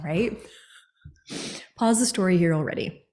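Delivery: normal talking voice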